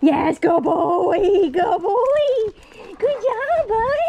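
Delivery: very high, loud dog praise; squeaky praising a dog. Real, not acted. Praising dog after find.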